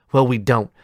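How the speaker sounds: loud sharp snap; snapped. A narrator's reading. Boy snaps back at his friends, loud and sharp. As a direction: bite the words off, angry. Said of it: "I snapped".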